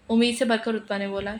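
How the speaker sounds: normal talking voice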